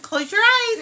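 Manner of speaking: high teasing close your eyes; teasing sing-song. Real, not acted. Mom teasing the girls before a surprise, sing-song high.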